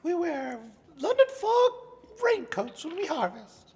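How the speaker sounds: high goofy yokel voice; silly high voice. Pretend. Joins the mock winery bit.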